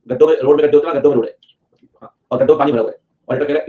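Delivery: usual speaking voice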